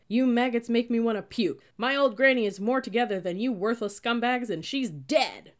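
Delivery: loud, high tirade, slowing on the last punch; contemptuous tirade. A narrator's reading. Cadet addressing four recruits, berating them loudly. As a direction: berate the whole squad.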